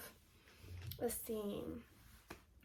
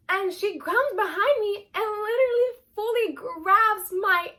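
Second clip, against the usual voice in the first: outraged retelling; 6 semitones higher, 5 dB louder, slower than usual. Real, not acted.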